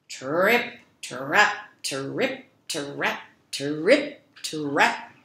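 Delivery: slow heavy hoofbeats, each word rising; heavy trip trap. A narrator's reading. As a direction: stomp across the bridge like a big goat. Biggest goat crossing bridge.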